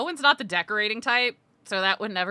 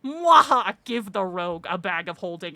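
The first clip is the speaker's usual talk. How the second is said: mustache twirling villain; 4 dB louder than usual. Pretend. Gloating over rogue's loot.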